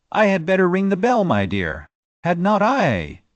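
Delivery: brisk, practical offer; a lightly voiced brisk Scottish lady offering to ring; briskly. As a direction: get things moving, no fuss. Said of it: "said Lady Glenmire briskly".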